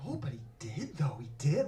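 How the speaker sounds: unvoiced conspiratorial whisper; whispered aside. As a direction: breathe it so only she hears. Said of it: "I was like".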